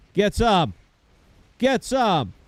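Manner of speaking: high, loud punchy burst; battle taunt shout. Real, not acted. Gamer at his enemies in battle, high taunting shout. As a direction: call it out over the gunfire.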